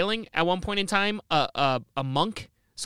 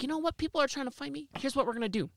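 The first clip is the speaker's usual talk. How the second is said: soft, sly voice, much quieter; hushed scheming Vlad; 3 semitones higher, 7 dB quieter, faster than usual. Retold or imitated. Vlad quietly schemes to burn his city. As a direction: let him plot it under his breath.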